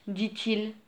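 ordinary delivery, nothing marked

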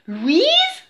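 high, loud, rising question; incredulous question. A narrator's reading. Jake to a strange dog that may be his sister, incredulous. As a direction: ask it in rising disbelief.

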